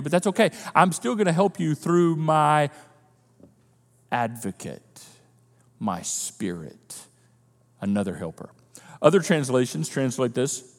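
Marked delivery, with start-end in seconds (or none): 4.1-8.5 much lower, much softer and slow; naming the Spirit in awe; reverent hush. Acted. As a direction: breathe the names reverently. Reverent whisper of titles.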